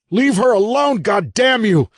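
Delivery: high, loud, raw shout at the captor; desperate shout. Acted. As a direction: scream it with everything, furious and desperate. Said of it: "Evan shouted".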